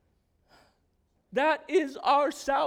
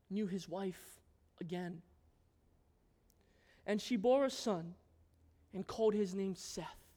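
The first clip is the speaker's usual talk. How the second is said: soft scripture reading; 6 semitones lower, 10 dB quieter than usual. Acted. Preacher closing the Cain and Abel story by reading scripture: very soft. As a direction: read it gently, almost under breath.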